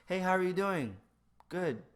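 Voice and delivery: high, loud automatic hello; impulsive greeting. Shown as a demonstration. Greeting someone while walking on, loud automatic cheer. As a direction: toss it out on reflex, then move on. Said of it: "saying something that's just an impulse".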